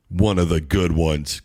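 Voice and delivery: low, sneering repeat; mock Nurkic. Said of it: "That's exactly what he sounds to me like".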